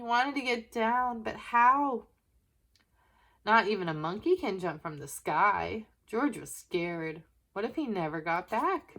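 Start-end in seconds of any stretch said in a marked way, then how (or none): none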